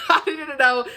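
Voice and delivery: laughing emphasis. Real, not acted. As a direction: laugh as you insist on it. Repeats line, second time bigger.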